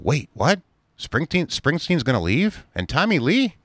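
high, incredulous questions rising at the ends; hammed-up astonishment; mock disbelief. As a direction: play shocked at the news, voice leaping up. Mock reaction to celebrities leaving.

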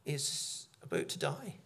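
whispered, slow and soft; breathy hush on the robber's end. Acted. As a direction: drop to a whisper for weight. Preacher hushes on robber's death.

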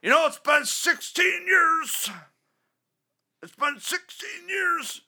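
whiny caller. Retold or imitated. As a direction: drag it out like a moaning fan. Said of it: "that same asshole that calls in".

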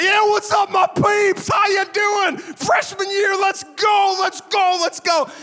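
high-pitched hyped-up jock shout; huge man-child. Retold or imitated. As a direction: holler it like a pumped freshman footballer. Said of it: "the biggest man-child of a boy".

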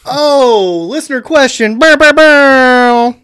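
loud, high mock fanfare, stretching out the last sounds; big game-show style holler; hollered segment jingle. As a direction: belt out a silly horn-like announcement.

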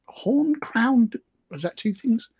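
ordinary delivery

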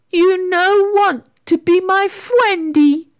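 put-on high, slow baby-talk wail; hurt creature in a tantrum; upset little sprite. A narrator's reading. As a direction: wail slowly at being rejected. Rejected sprite stamps foot.

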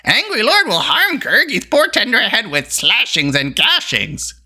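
high, whining creature voice; frightened little beast pleading; Gurgi howling. A narrator's reading. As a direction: wail pitifully in a high creature voice. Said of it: "howled Gurley, Gurgi".

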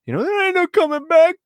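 high loud ominous line; ominous voicing. Host voicing the film's threat, high and loud. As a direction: spell out the threat.

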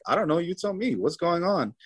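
high-pitched bewildered protest; retold bafflement. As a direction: toss the question back, pitch jumping up. Baffled, turns question back on family.

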